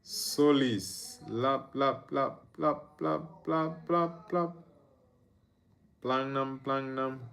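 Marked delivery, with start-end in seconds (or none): none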